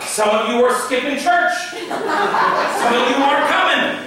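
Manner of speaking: loud, high fire-and-brimstone preaching; mock charismatic pastor calling out the flock; charismatic preacher. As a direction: scold the congregation from the pulpit. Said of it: "most pastors don't say it like that".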